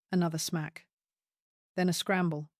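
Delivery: plain reading voice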